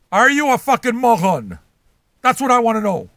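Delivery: furious rant at a moron; high, loud angry outburst; angry shout. Acted. Ranting at a moron.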